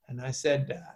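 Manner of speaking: ordinary talk, nothing marked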